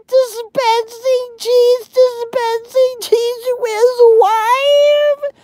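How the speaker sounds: very high, drawn-out falsetto mimicking critics; mocking whiny complaint. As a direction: whine it in a squeaky mock-sympathy voice. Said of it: "then all of you are bitching".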